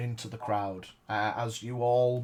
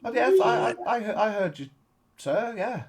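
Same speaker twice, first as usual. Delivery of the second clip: silly clown; 8 semitones higher than usual. Acted. Clown caught out, flustered.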